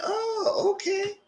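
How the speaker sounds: squeaky high "oh, okay" of mock surprise; surprised. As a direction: squeal it up high, caught off guard. Mock reaction to a reveal.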